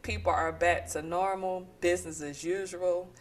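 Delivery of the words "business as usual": usual speaking voice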